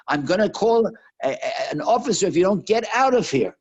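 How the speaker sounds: loud, high, yelled warning; angry threat. Retold or imitated. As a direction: shout a threat at an intruder. Angry person threatens the praying woman.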